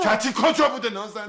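shouting. Acted. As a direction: yell her name in frustration. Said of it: "سر من داد نزن". (فریاد کلافه)

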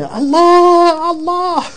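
high, loud cry of Allah; a desperate wailing call; retold scream.

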